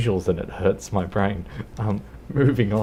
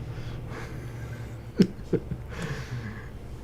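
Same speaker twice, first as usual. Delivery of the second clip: breathless, silent wheeze of a laugh; wheezing laugh; 5 semitones higher, 7 dB quieter than usual. Real, not acted. Streamer reading chat, wheezing laugh. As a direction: crack up without voice.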